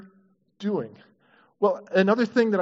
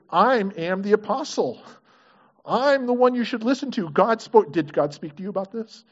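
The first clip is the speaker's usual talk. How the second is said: higher, insistent mock apostle voice; pulling rank; 4 semitones higher, 4 dB louder than usual. Imagined apostle demanding obedience from critics, mock overbearing. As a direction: hammer it out as a self-important authority. Contrasted authoritarian version of Peter.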